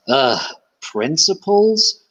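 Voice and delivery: imagined disdainful reaction; scoffing at principles. Acted. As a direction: say it slowly, as if principles were a joke. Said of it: "how Charlie reacted to that".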